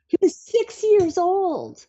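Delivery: high, slow, drawn-out astonishment; amazed emphasis. Real, not acted. Mom telling how her child coached her breathing, amazed emphasis. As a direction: stretch it out in high-pitched wonder.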